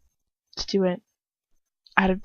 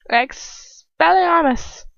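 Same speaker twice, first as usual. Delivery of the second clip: ordinary delivery, nothing marked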